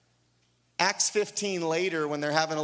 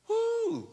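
high, drawn-out "woo"; high preacher's whoop of delight; excited whoop. Real, not acted. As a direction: let out a long high-pitched whoop. Excited preacher whoops between points.

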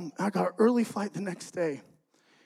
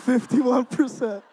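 slow, laughing repeat at normal pitch; amused repeat; 3 semitones higher, 4 dB louder, slower than usual. Real, not acted. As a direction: say it out loud with an incredulous laugh.

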